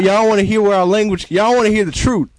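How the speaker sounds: high, loud preacher call to the crowd; preacher shouting. Acted. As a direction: belt it at the congregation like a fired-up pastor.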